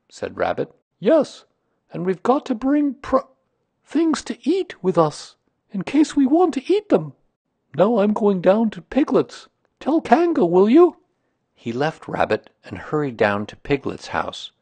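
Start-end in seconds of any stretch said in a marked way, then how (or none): 1.0-11.0 very high, loud bear voice; high-pitched bear, excited and unsure of the words; eager, muddled bear. Acted.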